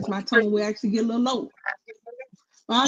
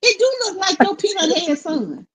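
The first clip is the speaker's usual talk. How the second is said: high, exasperated comeback; incredulous retort; 9 semitones higher than usual. Real, not acted. Viewer talking back at the TV mother, high and outraged.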